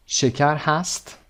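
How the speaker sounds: usual speaking voice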